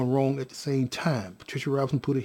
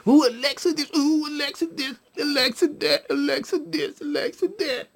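high-pitched singsong mimicry; mimicking fussing; 11 semitones higher than usual. Father mimics people fussing over Alexa, high mimicry. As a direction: parrot them in a high, fussing voice.

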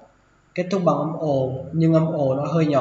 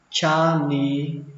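"janitor" slowly broken into ja-ni-tơ; syllable by syllable; slower than usual. Shown as a demonstration. Teacher first models the word for learners, slow and syllabified. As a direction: stretch out each syllable of "janitor".

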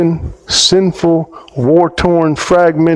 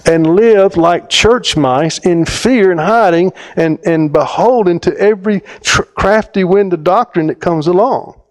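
higher, rising fervour; impassioned preaching; 5 semitones higher than usual. Acted. Preacher telling the congregation, fervent and rising. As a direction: build the passion line by line.

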